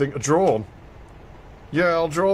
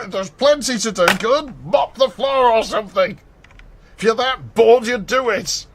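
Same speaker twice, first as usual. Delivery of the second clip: exasperated mum; 8 semitones higher, 5 dB louder than usual. Retold or imitated. Mum voiced in retold story.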